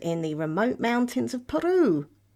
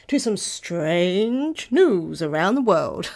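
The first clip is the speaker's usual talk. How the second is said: slow, louder mock announcer voice; 4 dB louder, slower than usual. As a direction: drawl it like a dramatic presenter. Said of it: "I don't know why I did that voice".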